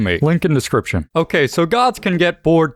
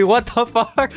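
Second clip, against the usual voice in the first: higher, incredulous question; baffled disbelief; 6 semitones higher, slower than usual. Real, not acted. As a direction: ask it in shocked disbelief. Reacting to "aggressive hospitality.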